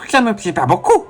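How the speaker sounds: high, spit-filled nerd voice; drooling nerd voice. Acted. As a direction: drool while talking nerdy. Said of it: "il faut que je prenne la voix et en même temps que je bave".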